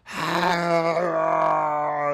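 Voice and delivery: high, loud monster scream. Acted. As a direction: cry out as it burns.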